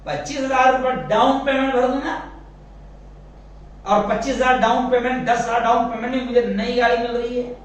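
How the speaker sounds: eager buyer. Acted. Preacher voicing a man eager for a loan bike. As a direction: sell yourself on the new bike.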